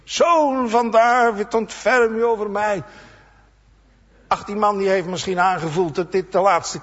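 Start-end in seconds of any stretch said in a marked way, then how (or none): 0.0-2.8 crying out again. Retold or imitated. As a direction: keep crying out without stopping. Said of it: "voortdurend schreeuwt".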